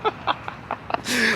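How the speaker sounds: breathless real laugh. Real, not acted. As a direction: laugh at the punchline. Laughs at own story.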